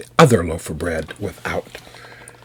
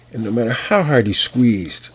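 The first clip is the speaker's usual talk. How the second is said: usual speaking voice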